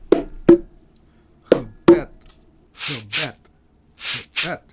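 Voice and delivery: "gebed" with unstressed GE and stressed BED; stress on second syllable. Shown as a demonstration. Teacher mimics word stress to a percussion beat, stress on BED in "gebed". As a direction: beat out "gebed" rhythmically, weak "ge", strong "bed". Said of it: "mimicking the word stress with a percussion instrument".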